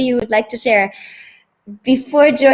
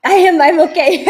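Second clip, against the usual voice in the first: higher, laughing reassurance; 6 semitones higher, 4 dB louder than usual. Real, not acted. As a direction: laugh through it, embarrassed. Laughing after coughing fit.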